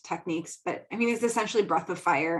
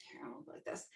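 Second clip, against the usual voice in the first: whispered aside; 20 dB quieter, faster than usual. Real, not acted. Aside while standing up for camera.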